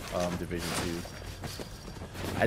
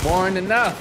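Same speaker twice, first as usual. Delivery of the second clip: higher, louder trash-talk shout; louder taunt; 10 semitones higher, 9 dB louder, slower than usual. Real, not acted. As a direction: bark it at the enemy.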